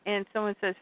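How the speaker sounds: ordinary delivery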